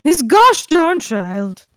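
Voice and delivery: hammed-up minced-oath grumble, drawn out; exasperated grumble. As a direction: punch out the tame curse, exasperated. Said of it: "muttering under her breath".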